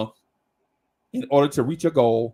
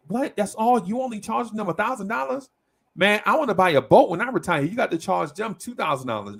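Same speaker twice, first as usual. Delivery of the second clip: greedy protest; 7 semitones higher than usual. Retold or imitated. Client to Mister Henry about the rent, high and whiny. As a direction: push for more money like a hustler.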